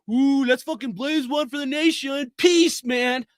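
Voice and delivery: mock stoner voice. Ranting man mocks flag-burning stoners in a lazy hippie voice. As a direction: sneer at them like a stoned hippie. Mocking stoners who burn flags.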